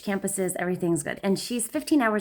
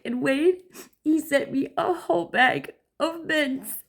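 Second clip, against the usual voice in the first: higher, slow teary whimper; imitating crying daughter; 7 semitones higher, slower than usual. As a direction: blubber it out high and slow.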